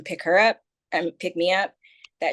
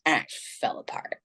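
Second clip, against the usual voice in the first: whispered, breaking off; hushed; 3 semitones lower, 5 dB quieter than usual. Real, not acted. Telling how she broke down, a soft whisper. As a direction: let the voice drop to a whisper.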